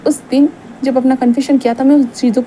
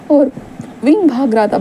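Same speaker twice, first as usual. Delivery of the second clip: plain reading voice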